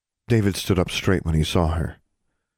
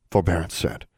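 ordinary delivery

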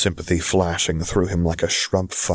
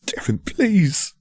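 high, slow, choked pleading; choked-up plea; 12 semitones higher, slower than usual. Acted. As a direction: plead through a tight throat. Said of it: "plead through a choked-up throat".

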